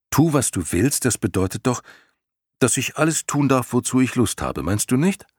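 put-on bright questioning child asking; eager boy voice. Bastian voiced higher. (eifrige Jungenstimme)

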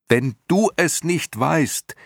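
high, insistent yelling. A narrator's reading. Boy pressing Morla for an answer, rising shout. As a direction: push the voice way up, demanding. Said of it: "schrie Atreo immer lauter".

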